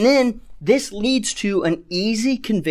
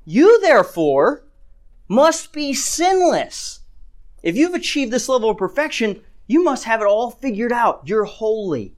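sarcastic; 5 semitones higher than usual. Retold or imitated. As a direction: exaggerate the praise, pitched up, to ridicule them. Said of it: "But he's doing it sarcastically".

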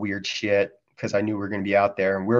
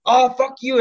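much higher, louder mock outburst; mock angry clerk; 14 semitones higher, 4 dB louder than usual. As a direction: snap back like an insulted vendor. Clerk swears back, voiced.

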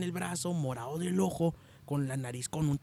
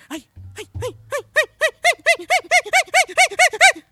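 very high, loud rising cries of ay; hammed-up moaning; 16 dB louder than usual. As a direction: build the mock cries up to a squeal. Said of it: "ya empiezas". (gemidos exagerados cada vez más agudos)

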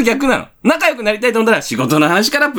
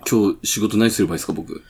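junior colleague; 12 semitones lower, 6 dB quieter than usual. Acted. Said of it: "佐々木後輩".

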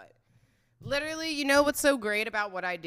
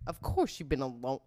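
ordinary talk, nothing marked

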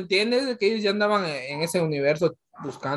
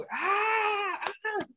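very high, soft mock scream; thin squealing imitation of an excited audience; imitated crowd scream; 12 semitones higher, 10 dB quieter than usual. Friend imitating their cinema audience, small high-pitched scream. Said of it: "Mi sala era como".

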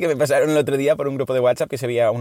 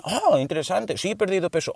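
higher, gushing approval; mock enthusiastic friend; 6 semitones higher than usual. Friend hears about the fad diet, higher gushing interest. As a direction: gush over the silly diet.

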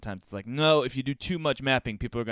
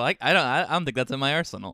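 ordinary delivery

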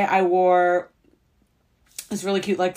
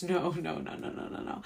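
fading whisper; 12 dB quieter than usual. Real, not acted. Refusing, dropping to a whisper.

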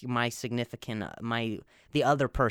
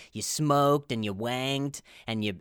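ordinary delivery

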